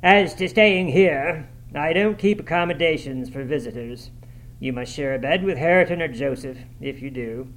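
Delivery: stern, emphatic opening easing off; a touch of stern master; Heathcliff. A narrator's reading. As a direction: refuse the guest sternly, leaning on the first words. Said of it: "Heathcliff's stern voice".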